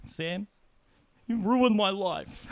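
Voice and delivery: hammed-up high wailing sob; mock crying. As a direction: whine it like a hurt kid, slow and drawn out. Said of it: "He's crying".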